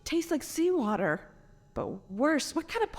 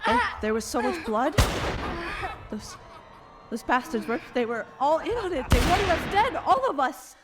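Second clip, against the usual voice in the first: rising panic; 5 semitones higher than usual. Acted. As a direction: let the memory build into terror. Recalling the bloody murder.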